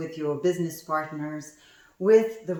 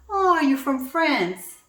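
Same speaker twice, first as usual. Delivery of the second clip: high, louder surprised question; a doctor caught off guard, higher and louder; 8 semitones higher, 6 dB louder than usual. Retold or imitated. Doctor hearing a French accent, surprised and loud. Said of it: "And the doctor says".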